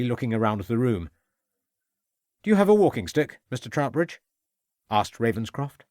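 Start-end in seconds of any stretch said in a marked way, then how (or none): 2.4-4.2 sudden question. A narrator's reading. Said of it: "suddenly changing tack".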